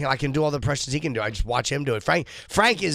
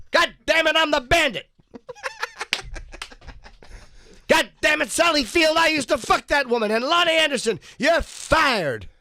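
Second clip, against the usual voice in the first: angry Burt Reynolds; 13 semitones higher than usual. Acted. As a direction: yell it as a furious washed-up star. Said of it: "What do you think that would have sounded like".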